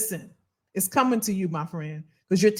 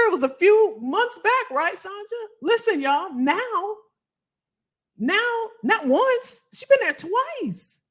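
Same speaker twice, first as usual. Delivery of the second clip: much higher, building excitement, slowing on twice; excited hype; 11 semitones higher, slower than usual. Real, not acted. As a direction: cheer her on with rising delight. Hyping a viewer's achievement.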